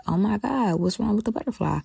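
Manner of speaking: playing her younger self fretting over a hurt butterfly; child's worried cry. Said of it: "my feelings was like".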